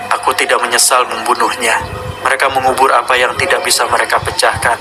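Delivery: a sinister Godzilla speaking from the tape; monster voice. Acted. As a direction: growl out the kaiju's confession.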